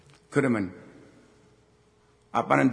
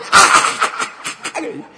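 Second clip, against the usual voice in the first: loud breathy cry; 12 dB louder than usual. Retold or imitated. Son thrown in arm wrestling.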